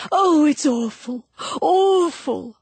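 high, loud anguished wail; passionate outcry. A narrator's reading. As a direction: cry out in passionate distress. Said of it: "cried out passionately".

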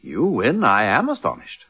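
ordinary talk, nothing marked

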